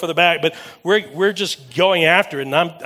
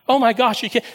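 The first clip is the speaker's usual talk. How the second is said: excited; 8 semitones higher, 3 dB louder than usual. Real, not acted. Moved by the old man's apology.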